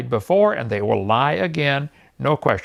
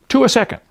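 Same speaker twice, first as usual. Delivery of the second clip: Two hit high and loud; incredulous stress; 7 semitones higher, 5 dB louder, faster than usual. Real, not acted. Preacher to the Mormons on the birth rate, incredulous stress on Two. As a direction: slam Two to show disbelief. Repeating the figure in amazement.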